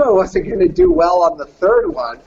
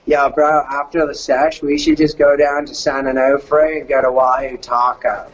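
imitated laid-back surfer bro, lower pitched; surfer dude talk; 5 semitones lower than usual. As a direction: do a mellow Californian surfer dude. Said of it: "I loved the way they talked".

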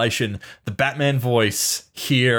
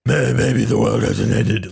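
mock Batman line; 3 dB louder, slower than usual. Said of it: "if he dropped the voice".

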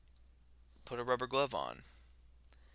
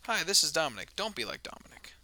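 mock PSA announcer; 6 semitones higher, 4 dB louder than usual. Mock public service announcement.

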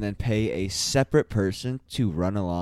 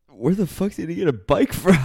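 higher, louder baffled question; incredulous; 8 semitones higher, 5 dB louder than usual. Retold or imitated. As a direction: toss it up in amused disbelief. Incredulous retelling, laughing.

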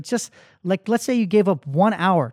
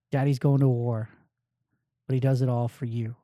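put-on gentle to the kids; 6 semitones lower, 5 dB quieter than usual. Telling the kids why.

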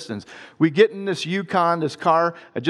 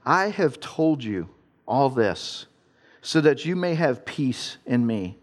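scripture reading; 3 semitones lower than usual. Acted. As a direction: read the verse gently and unhurried. Reads John 16:33 aloud.

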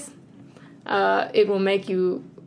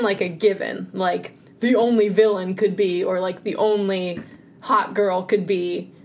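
ordinary delivery

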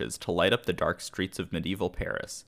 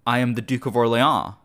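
raised, louder cry of his own name; narrated yell, announcing himself; 4 semitones higher, 6 dB louder than usual. A narrator's reading. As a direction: call out your rank to stop the robbers. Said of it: "Louis yelled".

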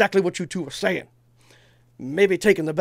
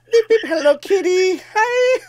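much higher, chirpy mock girly voice ending in a laugh; mocking Brandy answering a pink phone in a much higher girly voice; 7 dB louder than usual. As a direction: squeak it out like a giddy girl answering a toy phone. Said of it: "And she's like".

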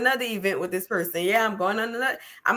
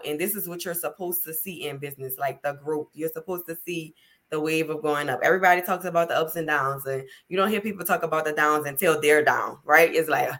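ordinary talk

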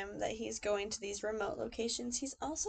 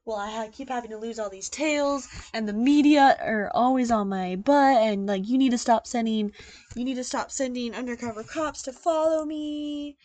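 mock paranoid suspect; 10 dB louder than usual. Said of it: "he was really like".

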